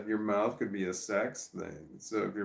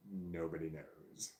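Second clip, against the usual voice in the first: hushed mysterious; 4 semitones lower, 9 dB quieter, slower than usual. Acted.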